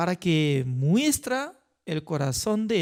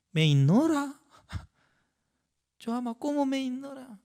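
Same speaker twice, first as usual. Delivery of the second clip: mock hurt, pitched higher and quick at the end; offended complaint; 6 semitones higher, faster than usual. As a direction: act out feeling ignored, half-laughing. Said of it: "¿cómo yo podría sentir?".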